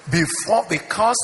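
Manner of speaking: high, ringing sermon delivery; impassioned preaching. Real, not acted. Pastor urging ministers to desire Christ's grace, impassioned and high. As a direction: preach it with rising fervour.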